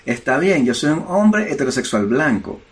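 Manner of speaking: ordinary talk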